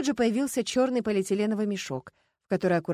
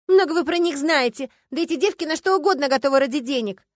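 high, loud, indignant scorn; heated retort; 7 semitones higher, 8 dB louder than usual. A narrator's reading. Starkova arguing with the investigator about those girls, indignant. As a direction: throw it back at them heatedly.

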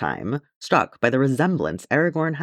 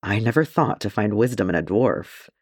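ordinary delivery

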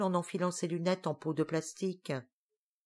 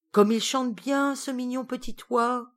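moved delight; 6 semitones higher, 3 dB louder than usual. Acted. Tatale moved by song.

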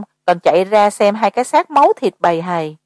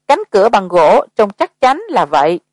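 usual speaking voice

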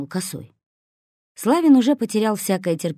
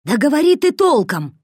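louder, much higher impatient shout; 9 semitones higher, 7 dB louder than usual. A narrator's reading. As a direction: yell at him to speak plainly. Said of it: "закричал на Косого Хмырь". (нетерпеливый крик, громче и выше)

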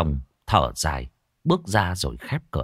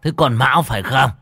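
louder, higher anxious call; nervous calling out; 6 semitones higher, 8 dB louder than usual. Acted. Old man calling out to a ghost, louder. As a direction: call into the empty room.

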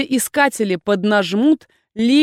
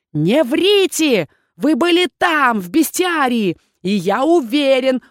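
accusing; 5 semitones higher, 5 dB louder, slower than usual. Acted. Dean accuses heroine.

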